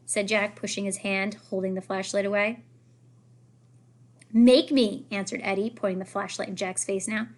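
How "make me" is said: loud, cocky challenge; defiant taunt. Acted. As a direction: dare him to try. Bully challenging.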